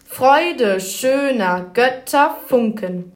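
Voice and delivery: very slow, stress on the first syllable of each pair; trochaic stress. Shown as a demonstration. Presenter demonstrating the trochee with Schiller, very slow beat. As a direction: hammer DUM-da DUM-da on Freude schöner. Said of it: "Der Trocheus hört sich so an".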